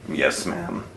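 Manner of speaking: a deep, quiet, dutiful butler. Acted. Butler Walter answers.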